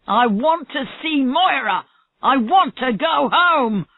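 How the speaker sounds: high, loud, slow furious shout; he's losing control; very angry. A narrator's reading. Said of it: "Marlowe was getting very angry".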